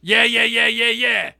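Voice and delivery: put-on high, loud cranky old man voice; mock cranky old man yelling yeah; old man impression. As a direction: squawk it like a crotchety old man. Mocking Fred Sanford voice.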